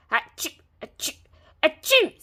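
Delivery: high loud acted sneezes. A narrator's reading. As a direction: sneeze three times big. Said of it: "sneezed the three little pigs".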